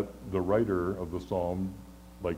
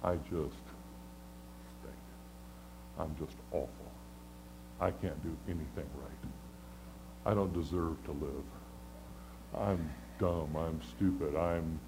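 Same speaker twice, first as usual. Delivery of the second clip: low, much softer, defeated; self-loathing mutter; 6 semitones lower, 10 dB quieter than usual. Retold or imitated. A preacher voicing the congregation's self-loathing, softly defeated. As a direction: mutter it like you've given up on yourself. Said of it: "We despise ourselves".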